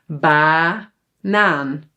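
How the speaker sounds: BANAAN drawn out with long open A in both syllables; long open vowels. Shown as a demonstration. Teacher shows learners long vowels in BANAAN, drawn out. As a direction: split BANAAN slowly, ba-naan, both A's long. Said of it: "you have to pronounce it long".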